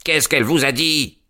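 put-on the old man barks his question; furious shout. A narrator's reading. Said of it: "cria le vieillard furieux". (cri furieux du vieillard)

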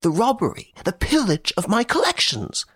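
a nobleman panicking over his treasures; high, anxious protest; frantic Baron. Acted. Baron frantic about robbery.

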